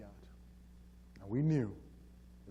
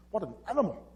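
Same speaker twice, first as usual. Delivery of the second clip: raised, louder burst of disgust; retold outrage; 7 semitones higher, 6 dB louder, faster than usual. Corey wanting to hit his boss, retold outraged thought. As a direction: imitate an indignant inner outburst.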